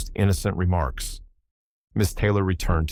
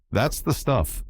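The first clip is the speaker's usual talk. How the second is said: approving; 6 semitones higher, slower than usual. A narrator's reading. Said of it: "said Jimmy approvingly".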